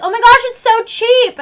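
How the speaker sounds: high, loud mock delight; gushing tourist. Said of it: "they're like".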